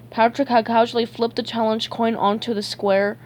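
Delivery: plain reading voice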